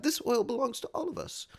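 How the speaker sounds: high mock noble speech; mock lady voice. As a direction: play it up as a grand lady. Voicing Lady MP.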